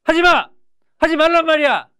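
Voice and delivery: high, loud, curt commands; short forceful shout. Shown as a demonstration. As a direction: fire it out short and strong. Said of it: "좀 더 짧게 말해볼까?".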